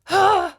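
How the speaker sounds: sharp loud dramatic gasp. Pretend. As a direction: gasp as if shocked. Mock shock at twist.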